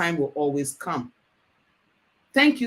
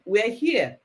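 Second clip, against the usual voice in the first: ordinary talk, nothing marked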